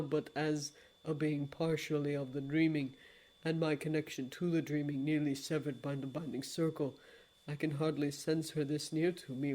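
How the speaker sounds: low, soft, solemn regret; a low, hushed apology; grave lord of dreams. Acted. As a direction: speak like a deep and remorseful old god.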